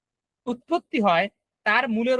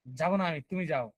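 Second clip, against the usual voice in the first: low-pitched anger; 6 semitones lower, 6 dB quieter than usual. Shown as a demonstration. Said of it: "anger হবে না?".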